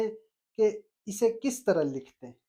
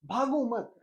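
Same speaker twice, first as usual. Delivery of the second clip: ordinary talk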